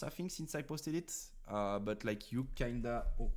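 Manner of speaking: usual speaking voice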